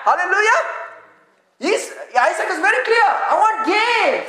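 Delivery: high, rousing preacher's call; fervent preaching. Real, not acted. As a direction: preach it out, stretching the key words. Preaching at full force.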